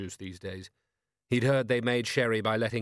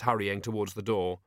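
ordinary delivery, nothing marked

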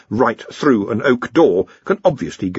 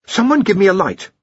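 higher-pitched urgent burst; in a rush; 10 semitones higher, 4 dB louder than usual. A narrator's reading. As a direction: blurt out the request excitedly. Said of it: "It came out in a rush".

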